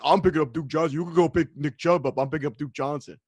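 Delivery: lightly voiced dumb fan; stupid fantasy fan voice. Host quotes people on Twitter, lightly mocking fan voice. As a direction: imitate a clueless online fan, understated. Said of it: "the stupid fantasy person voice".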